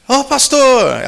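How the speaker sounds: Ô, pastor! bem mais alto e agudo; chamado animado. Retold or imitated. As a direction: cumprimento alegre, em voz aguda. Homem animado no aeroporto. (saudação alegre de quem reconhece o pastor)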